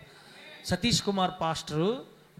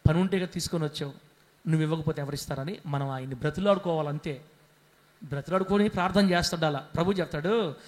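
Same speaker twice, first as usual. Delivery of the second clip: usual speaking voice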